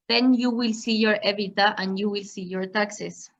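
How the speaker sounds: normal talking voice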